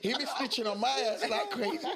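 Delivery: laughing while talking; giggling through the line. Real, not acted. Keeps laughing about being exposed.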